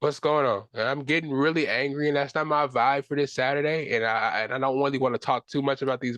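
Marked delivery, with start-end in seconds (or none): none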